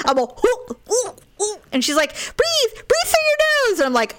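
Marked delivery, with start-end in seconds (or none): 0.5-1.6 high-pitched mock gag noises; imitated gagging. Patient mimics herself at the dentist, mock gagging. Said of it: "And I'm gagging". 2.1-3.8 very high, drawn-out mock urging; urgent coaching. Dentist to gagging patient, urgent high-pitched coaching.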